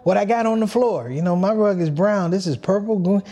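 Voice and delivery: high-pitched mock dad, baffled and scolding; imitating his dad.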